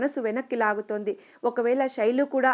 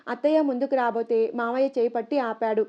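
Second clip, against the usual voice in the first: ordinary delivery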